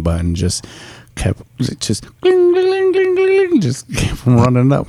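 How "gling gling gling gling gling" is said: notification chimes. Retold or imitated. Imitating notification sound.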